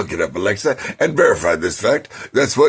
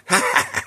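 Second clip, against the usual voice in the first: high cackle. Retold or imitated. Monkey cackling.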